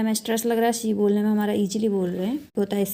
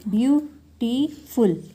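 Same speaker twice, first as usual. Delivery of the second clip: "Beautiful" very slowly in three parts; syllable by syllable; 3 semitones higher, much slower than usual. Shown as a demonstration. Teacher counting a word's syllables, slow and separated. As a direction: stretch out "beautiful" as view, टी, फुल with gaps. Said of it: "तीन syllable हो गए".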